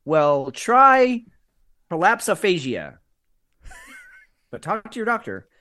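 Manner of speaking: high, loud drug ad pitch; cheerful ad with eerie menace. Pretend. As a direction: sell it like a pharma commercial. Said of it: "we should do a drug commercial for that".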